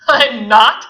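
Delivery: loud mocking question. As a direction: scoff the question.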